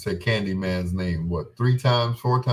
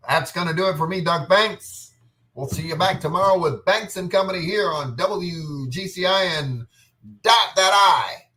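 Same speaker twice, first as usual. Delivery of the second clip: higher announcer voice, building louder; radio sign-off; 8 semitones higher, 4 dB louder than usual. Acted. Closing the show for WGCI listeners, punchy announcer voice.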